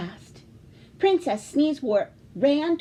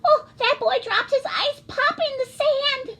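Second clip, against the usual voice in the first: much higher, sweet princess voice; oblivious princess; 14 semitones higher, 3 dB louder than usual. Acted. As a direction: chirp it like a carefree princess. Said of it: "said Princess Sneezewort".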